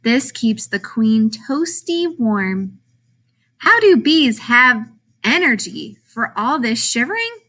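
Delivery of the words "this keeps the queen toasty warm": usual speaking voice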